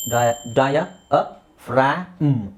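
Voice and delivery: ಡಯ, ಅ, ಫ್ರಾ, ಮ್ in separate pieces; teacher's piecewise ಡಯ ಅ ಫ್ರಾ ಮ್, no g; broken into sounds. Shown as a demonstration. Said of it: "ಜಿ silent".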